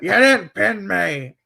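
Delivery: put-on Pac's English accent. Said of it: "this is gonna be a terrible accent".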